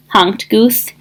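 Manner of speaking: ordinary delivery, nothing marked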